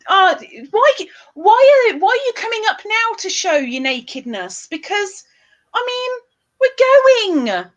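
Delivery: high, loud shocked scolding; mock outrage. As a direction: play scandalised at the trolls.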